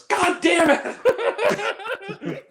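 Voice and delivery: stretched mock outcry; exasperated shout. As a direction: let out a dragged, laughing groan of a curse. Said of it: "He goes".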